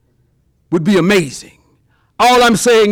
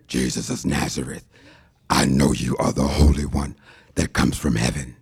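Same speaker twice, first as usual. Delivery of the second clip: much lower, softer, growling demon voice; 8 dB quieter than usual. Acted. Demon answering Jesus, very low and softer. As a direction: sink deep and dark like a demon.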